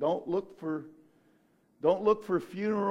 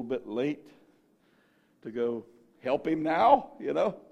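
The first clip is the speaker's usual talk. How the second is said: hesitant, lower; 7 semitones lower than usual. Retold or imitated. Preacher voicing disciples telling Jesus he waited too long, sheepish. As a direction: break bad news awkwardly.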